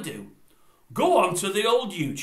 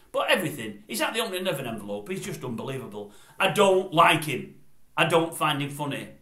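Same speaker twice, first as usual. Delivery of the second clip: usual speaking voice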